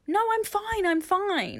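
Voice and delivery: high, mock insistence; insistent denial. Friend imitating her denial, high and insistent. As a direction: insist a little too brightly. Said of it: "it's also like".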